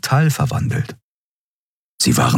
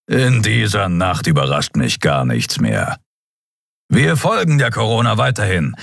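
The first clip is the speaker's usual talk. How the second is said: world-weary captain; 3 semitones higher than usual. A narrator's reading. Captain answers his baffled officers, wearily unsurprised. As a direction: pronounce it with unhurried authority.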